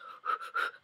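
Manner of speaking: a quick startled gasp in; sharp gasp. Retold or imitated. Fan seeing her favourite actor, a startled gasp. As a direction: gasp like you just saw your idol. Nervous fan meeting celebrity.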